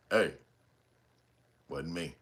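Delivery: low deadpan denial, barely above a murmur; straight-faced denial. Retold or imitated. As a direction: mumble it low with a straight face. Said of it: "They got that face on".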